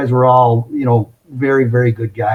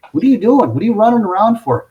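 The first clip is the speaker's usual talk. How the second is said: mildly exasperated questioning, pitched up; acted-out higher, quizzical demand; puzzled challenge; 6 semitones higher than usual. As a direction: fire questions at someone rushing about. Finance guy challenges the running salesman.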